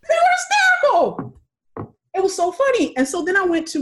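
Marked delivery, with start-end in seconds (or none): 0.1-1.3 very high, excited squeal; gleeful high-pitched emphasis; hysterical delight. Real, not acted. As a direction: exclaim it at the top of your range.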